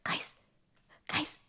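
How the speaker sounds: urgent whisper to classmates. Retold or imitated. To fellow students in class, urgent whisper. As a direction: hiss it under your breath. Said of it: "I was like".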